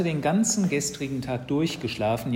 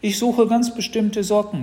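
high, loud excuse-making; pitched-up, overeager boy; caught-out boy; 10 semitones higher, 8 dB louder than usual. Acted. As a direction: insist on the fib to your mother. Boy makes excuse to mother.

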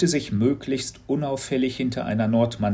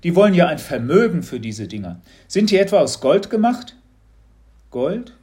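cheerful grumbling father, quick and loud; a dad proud of his bargain; lightly hammed-up excited father; chatty dad; 7 semitones higher, 6 dB louder than usual. A narrator's reading. Papa voiced in story.

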